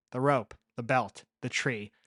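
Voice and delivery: usual speaking voice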